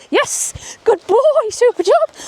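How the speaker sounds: very high, loud praise for the dog; high, loud good boy; high excited praise. Shown as a demonstration. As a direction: cheer the dog on. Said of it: "real life example".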